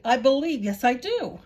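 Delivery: donkey crying out that he believes; bursting out. A narrator's reading. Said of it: "he repeated".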